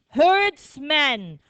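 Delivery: "herdsman" slowly in two clear parts; model pronunciation. Shown as a demonstration. Teacher has class say the new word, model pronunciation. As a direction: say "herdsman" drawn out for repeating. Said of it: "Say it".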